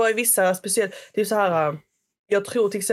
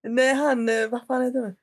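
usual speaking voice

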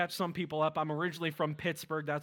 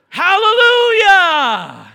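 long, high, ringing shout, slowed right down; a drawn-out preacher's hallelujah; shouted praise; 23 dB louder, much slower than usual. Real, not acted. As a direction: lift the whole room with it.

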